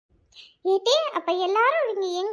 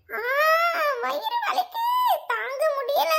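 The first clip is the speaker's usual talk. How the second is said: high-pitched mock sobbing wail; fake crying; 5 semitones higher, slower than usual. Friend told to act as if crying from stomach pain, putting on a high tearful wail. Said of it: "அழுத மாதிரி நடிடி".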